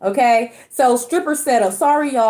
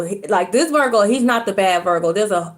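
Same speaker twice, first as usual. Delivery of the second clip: ordinary talk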